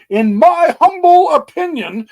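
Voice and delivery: high, loud, hammed-up humble voice; mock humble emphasis. Shown as a demonstration. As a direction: lean on opinion, playing it grand. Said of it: "emphasis on opinion".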